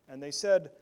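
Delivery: usual speaking voice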